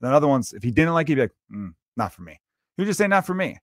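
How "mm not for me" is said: low, soft, offhand shrug of a reply; dismissive mumble. Retold or imitated. Musician in a comfy chair shrugging off a song he dislikes, offhand mumble. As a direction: brush the song off quietly, barely bothered.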